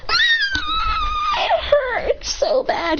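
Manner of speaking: high, slow pained wail; hammed-up crying. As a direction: wail in mock agony, drawing words out. Hurt by the grenade, crying.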